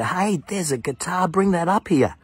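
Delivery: calling down to a man with a guitar; yelled call. Retold or imitated. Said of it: "I yelled out".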